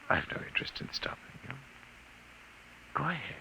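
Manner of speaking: soft, quick whispered taunt; menacing whisper. Acted. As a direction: breathe the dare under your breath. Alien taunts him to leave.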